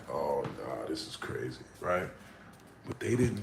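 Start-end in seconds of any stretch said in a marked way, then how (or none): 0.1-1.6 low, hushed mock murmur; murmuring crowd. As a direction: mutter it like a doubtful crowd. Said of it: "a whole murmur in the room".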